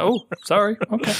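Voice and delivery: high, meek apology; sheepish. Retold or imitated. Customer scolded over a mocha, sheepishly apologising.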